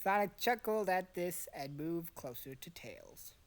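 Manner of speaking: usual speaking voice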